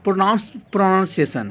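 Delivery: teacher modelling pronounce and pronunciation very slowly; slow pronunciation demo. Shown as a demonstration. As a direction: pronounce then pronunciation, each syllable drawn out. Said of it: "introduce of pronounce".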